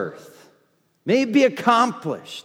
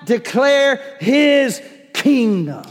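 declaring with faith; 4 semitones higher than usual. Shown as a demonstration. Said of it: "you speak it with faith".

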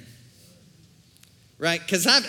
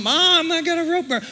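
put-on a kid whining to mom, much higher; whiny kid; 11 semitones higher, 5 dB louder than usual. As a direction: play the kid complaining about his hand. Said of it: "somebody got a rope burn".

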